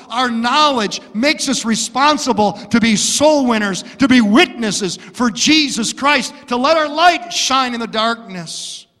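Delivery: loud, high preaching; full-force preaching. Acted. Pastor urging the church to win souls, loud and high. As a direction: drive the warning home from the pulpit.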